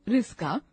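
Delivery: रिस्का, with s instead of ksh; mispronounced rickshaw. Shown as a demonstration. Teacher showing a common mistake, रिस्का with plain s. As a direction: say रिस्का the careless way. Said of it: "अशुद्ध शब्द बोलते हैं".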